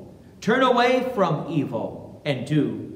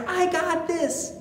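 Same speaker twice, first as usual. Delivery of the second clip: higher, cocky boast; overconfident Peter; 9 semitones higher than usual. Retold or imitated. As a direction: brag it lightly like a sure disciple. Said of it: "He basically says to our Lord".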